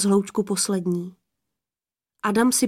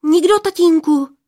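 high, loud, hasty denial; startled girlish outburst; hasty blurting; 8 semitones higher, 8 dB louder than usual. A narrator's reading. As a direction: blurt it out innocently to father. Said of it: "vyhrkla Poměnka".

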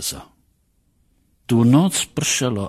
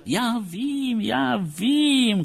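high, singsong humouring of a patient; drawn-out, high-pitched placating; soothing; 13 semitones higher, much slower than usual. A narrator's reading. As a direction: coo it to calm him down. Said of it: "konejšil ho".

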